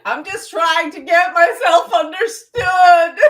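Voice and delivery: high, loud laughing cry; laughing shout. Real, not acted. Laughing loudly at her own mixed-up language. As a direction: laugh it out loudly while speaking.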